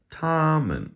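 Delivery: TOMMEN high on TOM, MEN dropping away; neutral-tone ending. Shown as a demonstration. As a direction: hold TOM high and loud, let MEN fall off toneless. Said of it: "falls off at the end".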